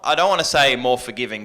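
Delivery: ordinary delivery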